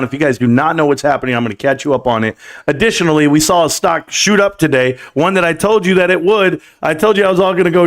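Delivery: loud, pumped live-stream intro; hyped show host. Acted. Host hyping up his live viewers, loud and pumped. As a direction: fire up the chat like a hype host.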